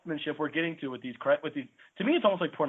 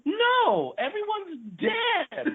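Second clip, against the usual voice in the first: mock outraged cry; much slower than usual. Film fan mocking a hero's happy ending, high incredulous cry. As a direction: wail it in comic disbelief. Exasperated reply to imagined cheery question.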